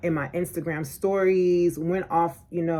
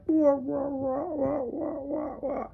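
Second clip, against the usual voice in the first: high, soft, stretched wah-wah noise; drawn-out slow-motion wobble; 7 semitones higher, 4 dB quieter, slower than usual. Retold or imitated. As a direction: imitate a slow-motion fall with wobbling wahs. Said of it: "you see it in slow motion happening".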